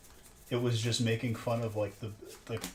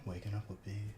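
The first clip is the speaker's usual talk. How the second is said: much softer mock host voice announcing the show title; soft show intro; 2 semitones lower, 10 dB quieter than usual. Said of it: "a nice, like, soft intro".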